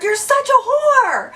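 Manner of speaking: very high, drawn-out mocking call; teasing taunt. Making fun of her friend, teasing taunt. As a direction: jeer playfully, stretching it out. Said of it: "I was making fun of her".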